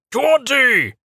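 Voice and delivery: very high, loud shout; angry call. A narrator's reading. Tall acolyte stomping toward the wagon, shouting the name. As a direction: shout the name across the yard.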